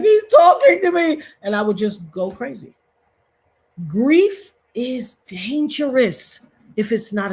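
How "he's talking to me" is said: high, loud wail; overwhelmed cry. Retold or imitated. Grieving widow hearing a song on the radio, loud wail. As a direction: burst out like it's a sign. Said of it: "I'd be like".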